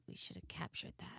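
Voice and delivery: whispered aside to yourself; whispering inner voice. Retold or imitated. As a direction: whisper it like a private regret. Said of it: "we all have that voice".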